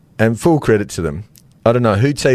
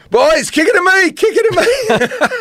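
put-on Irish accent. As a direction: cry out high in an Irish accent. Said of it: "in that Irish accent".

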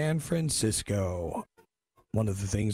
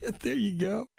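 laughing; faster than usual. Real, not acted. Host laughs at hip dude.

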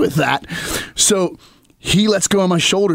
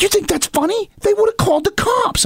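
much higher, disbelieving scold; mock shrill wife telling him off; wife scolding him over the prank; 14 semitones higher than usual. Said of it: "she's like".

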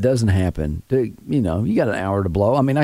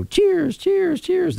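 very high repeated chirp; imitated bird call; much slower than usual. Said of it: "all of a sudden you'll hear a bird".

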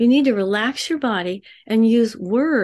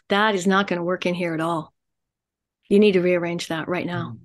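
lower, firm and deliberate; stern low warning; 5 semitones lower than usual. Shown as a demonstration. Counsellor showing a man in her office a stern firm voice. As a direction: lay down the law calmly. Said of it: "I will look at them like this and I will say".

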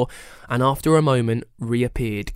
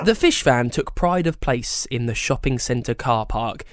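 usual speaking voice

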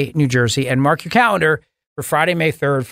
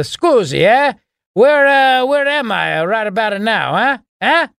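Joe Biden in Italian; 5 semitones higher, slower than usual. Acted. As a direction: ham up a lost Biden with an Italian accent. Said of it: "it would sound even funnier in Italian".

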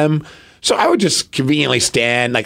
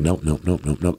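low, soft repeated nopes under the breath; quietly shaking it off to himself; muttered nope; 9 semitones lower, 6 dB quieter than usual. Retold or imitated. Scanning the match board, he mutters nope to himself.

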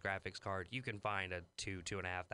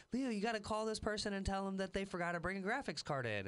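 joking voice; 11 semitones higher than usual. Retold or imitated. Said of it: "I always make the same joke".

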